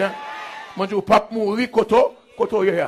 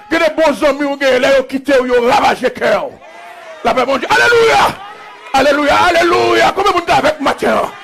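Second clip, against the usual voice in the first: full-force preaching; 7 semitones higher, 5 dB louder than usual. Real, not acted. Preacher calling on the morning congregation to believe, full-force preaching. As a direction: whip the congregation up into a response.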